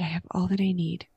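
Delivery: soft breathy whisper of "I have all that I need"; whispered on the out-breath. Retold or imitated. Mother praying on the hospital couch, whispered exhale. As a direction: let the words ride out on the exhale. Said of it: "And as I breathed out".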